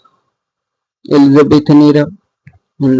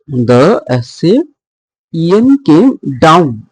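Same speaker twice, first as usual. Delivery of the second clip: slow scansion, landing hard on "down"; stress on down; much slower than usual. Shown as a demonstration. Teacher scanning the Assyrian line, slow with stress on "down". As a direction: draw the words out and stress "down". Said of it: "stress in the down".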